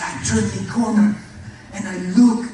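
loud, high, breathless build to the discovery; excited retelling. Retold or imitated. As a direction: call it out as the big reveal. Father recalls finding son standing.

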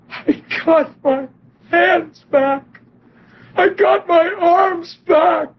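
high, slow, rising mock crying cry; half-performed cry of a healed man; retold crying scream. Healed man shouting to the preacher, a retold crying scream. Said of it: "He's screaming it out, crying".

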